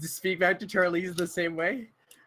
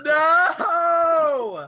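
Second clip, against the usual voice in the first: a much higher, louder cry of "No!"; anguished shout; 13 semitones higher, 10 dB louder than usual. Real, not acted. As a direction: wail it out in real dismay. Player cries out at the roll.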